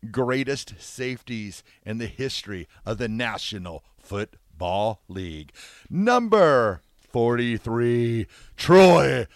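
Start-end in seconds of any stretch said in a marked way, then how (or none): 5.9-9.3 slow, drawn-out, loud announcer reveal; dramatic announcer. Acted. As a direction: stretch it like a ring announcer.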